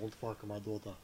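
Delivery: a hint of Bruce Willis, unbothered; Bruce Willis impression. Bruce Willis warning men off his daughter, lightly voiced. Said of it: "That's what Bruce Willis sounds like".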